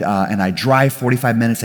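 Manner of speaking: ordinary talk, nothing marked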